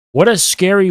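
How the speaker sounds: normal talking voice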